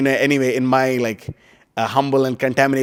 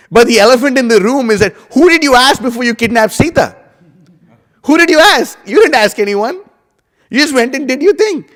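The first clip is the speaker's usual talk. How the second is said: higher and louder, pressing the accusing question; indignant challenge; 8 semitones higher, 5 dB louder than usual. Real, not acted. Teacher confronting Ravana in his story, higher and louder, indignant. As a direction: demand an answer from a guilty king.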